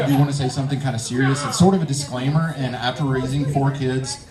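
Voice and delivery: ordinary talk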